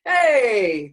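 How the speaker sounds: full loud chest-voice hey; loud chest call. Shown as a demonstration. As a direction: belt it from the chest. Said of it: "just calling out".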